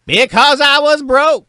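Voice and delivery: hammed-up signature line; catchphrase. Shown as a demonstration. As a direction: belt it out like a sitcom tagline. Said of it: "Okay, try it again".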